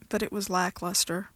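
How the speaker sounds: plain reading voice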